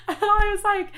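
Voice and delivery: high, loud laughing repeat. Real, not acted. Laughing at herself over a haircut tantrum, high. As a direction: laugh through it at yourself.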